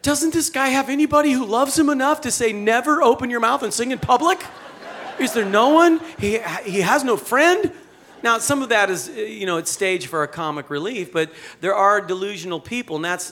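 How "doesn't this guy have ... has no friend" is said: high, loud exasperated disbelief; incredulous outburst. Retold or imitated. Preacher yelling at the singer on screen, incredulous. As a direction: plead at the TV in mock outrage. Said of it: "I'm like".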